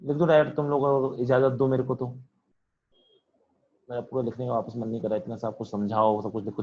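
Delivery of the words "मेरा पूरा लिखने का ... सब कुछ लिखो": grumble. Real, not acted. Teacher grumbling about writing everything.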